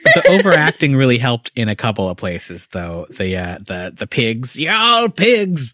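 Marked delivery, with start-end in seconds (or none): 4.6-5.7 high, slow villain snarl; hammy villain. Retold or imitated. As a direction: ham it up like a movie villain. Quoting overacted villain line.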